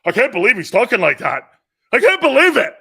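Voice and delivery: mock a shocked critic, getting shriller; hammed-up outrage, climbing high and loud; mocking outraged liberal. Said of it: "some maniac liberal thinks that".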